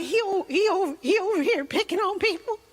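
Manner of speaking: high whiny stuttering complaint; hammed-up wounded tattling; mock tearful. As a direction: imitate a grown man whining near tears. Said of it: "Are you crying?".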